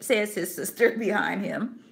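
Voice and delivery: earnest read-aloud narration with voiced characters; story reading. Teacher reading a moral story to her class, expressive. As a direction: read the old tale to the class, lively.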